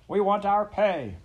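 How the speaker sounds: high, loud, slow shouted demand; a worker yelling his claim. A narrator's reading. Angry railroad worker demanding his pay, slow loud shout. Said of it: "other voice shouted".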